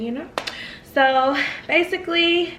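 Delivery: normal talking voice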